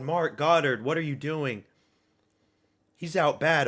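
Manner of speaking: ordinary delivery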